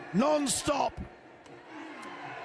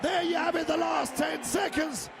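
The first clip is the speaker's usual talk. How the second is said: raised, higher shouted fight call over the final seconds; live play-by-play; 5 semitones higher than usual. Acted. Commentator calling the fight's end to viewers, excited higher shout. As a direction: call the last seconds like the crowd can't hear you.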